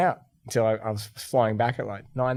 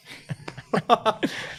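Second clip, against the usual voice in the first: airy laugh; 11 semitones higher than usual. Real, not acted. Laughing at own story.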